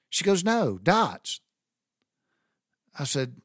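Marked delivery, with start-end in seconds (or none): 0.4-1.4 "dots" for darts, high and r-less; Bostonian dropped R. Retold or imitated. Mother-in-law correcting his guess, higher, R dropped. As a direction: insist on "dots", higher, with the R missing. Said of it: "she wasn't saying dots".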